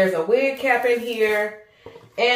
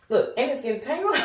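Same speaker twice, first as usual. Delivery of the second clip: giggling at the tangle; a quick amused giggle as the net tangles; laughing; 5 semitones higher, 4 dB quieter, faster than usual. Real, not acted.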